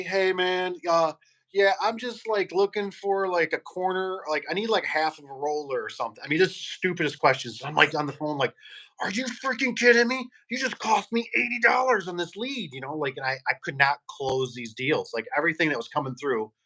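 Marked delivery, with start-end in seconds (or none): 0.0-6.2 higher, dopey mock caller; mock customer voice, pitched up; clueless caller. 9.0-12.7 high, exasperated mock rant; retelling his fury at a wasted lead, pitched high; exasperated outburst. Retold frustration on phone.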